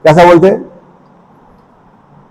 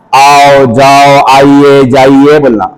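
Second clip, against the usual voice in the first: slow demo; 2 semitones lower, much slower than usual. Shown as a demonstration. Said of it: "अच्छे अल्फाज बोलना".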